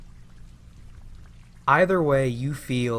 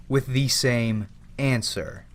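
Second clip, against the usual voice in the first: normal talking voice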